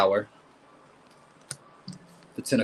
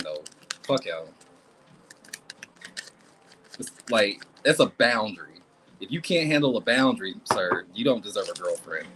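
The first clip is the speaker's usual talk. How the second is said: ordinary delivery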